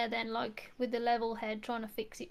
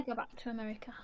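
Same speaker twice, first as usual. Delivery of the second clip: whispered; 7 dB quieter, faster than usual. Real, not acted. Wistful aside.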